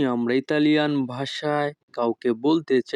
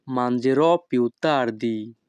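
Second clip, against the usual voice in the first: each Italian word spoken slowly and clearly; teacher modelling Italian for learners, very slow; slow demo; much slower than usual. Shown as a demonstration. Bengali teacher models Italian phrase for students, slow and careful. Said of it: "তখন আমাদেরকে বলতে হবে".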